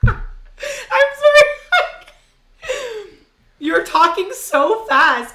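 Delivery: high laughing, stretched words. Real, not acted. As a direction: laugh through the apology. Laughing fit.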